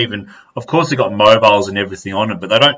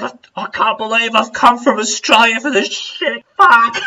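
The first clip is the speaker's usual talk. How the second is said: high-pitched comic whimpering; mock crying in pain; 10 semitones higher than usual. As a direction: retell it as an agonised, tearful whine. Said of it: "start fucking crying from pain".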